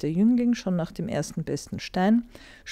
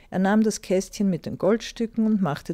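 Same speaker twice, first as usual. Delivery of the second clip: ordinary delivery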